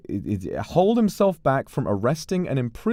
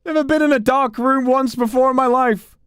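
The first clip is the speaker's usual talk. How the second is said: hammed-up high, loud panic; scared yelling; 12 semitones higher, 8 dB louder than usual. Player calling the sheriff back in the dark, hammed-up scared yelling. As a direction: cry out for rescue in exaggerated fright. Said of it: "I'm scared!".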